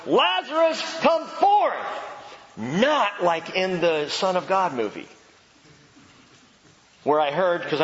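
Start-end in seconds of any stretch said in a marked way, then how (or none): 0.0-1.7 high, loud commanding call; ringing shout of command; loud cry. Acted. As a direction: call him out of the tomb. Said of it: "he cried out with a loud voice".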